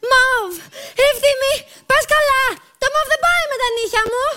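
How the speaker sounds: high, affronted diva protest; indignant complaint. Acted. Girl objects to mauve clashing with her nails, indignant.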